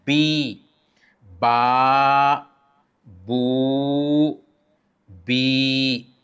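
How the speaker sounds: बी, बा, बू held long; long vowel drill. Shown as a demonstration. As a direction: sustain each vowel. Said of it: "बा के साथ या लाएंगे". (लंबी हरकत)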